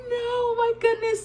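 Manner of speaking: a whimpering no, my goodness; mock despair. She wails that her nose is too stuffy.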